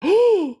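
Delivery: high gasp at a reveal; astonished high-pitched gasp. Real, not acted. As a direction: draw in a sudden high breath. Reveals the tarot card.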